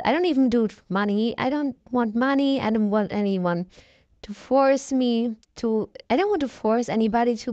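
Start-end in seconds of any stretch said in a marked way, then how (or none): none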